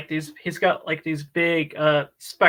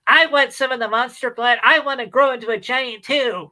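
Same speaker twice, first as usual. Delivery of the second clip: put-on high nasal know-it-all kid; whiny science-kid voice; nasally nerdy kid voice; 8 semitones higher, 4 dB louder than usual. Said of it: "this very kind of nasally voice".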